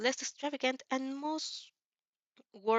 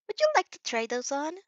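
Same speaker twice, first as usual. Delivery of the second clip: a higher, cheerful saleslady; bright customer-service voice, a bit higher; friendly shop assistant; 7 semitones higher, 3 dB louder than usual. A narrator's reading. The saleswoman offers the customer a fitting room, cheery.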